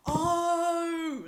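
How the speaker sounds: long, loud, high "oh"; sudden realisation. Retold or imitated. Baffled friend finally understanding the phrase, loud realisation. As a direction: draw out a huge penny-drop moment.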